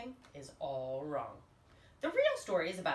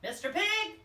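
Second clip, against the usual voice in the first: louder, much higher call at the door; 9 semitones higher, 5 dB louder than usual. Acted. Wolf calling to the third pig, raised voice. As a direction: hail the third pig brightly. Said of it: "I called".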